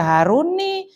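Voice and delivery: "Haruni" with a final i in place of u; wrong i ending. Shown as a demonstration. Teacher shows the class a forbidden reading, "Haruni" ending in i. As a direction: end "Haruni" on a clear i. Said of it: "nggak boleh dibaca".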